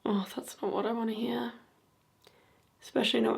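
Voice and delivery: hushed and uneasy; scared whisper. Real, not acted. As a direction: whisper it, spooked. Unnerved reply to spirit box.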